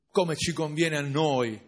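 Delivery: ordinary delivery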